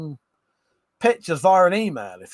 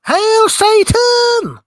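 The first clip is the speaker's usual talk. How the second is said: slow, much higher and louder demonic yell; Nicolas Cage shout; 10 dB louder, much slower than usual. Retold or imitated. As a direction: drag out a hammed-up satanic scream. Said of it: "Nicolas Cage did go".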